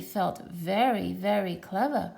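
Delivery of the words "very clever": ordinary delivery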